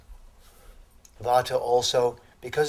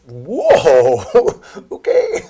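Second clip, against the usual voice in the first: loud startled "whoa" breaking into a laugh; louder amazed gasp melting into laughter; 4 semitones higher, 15 dB louder, much slower than usual. Retold or imitated. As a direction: jump back in mock alarm, then laugh it off. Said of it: "and everyone goes".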